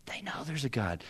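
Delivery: soft unvoiced whisper. Real, not acted. Preacher whispers to the church they know. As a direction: confide it gently. Confides a conviction.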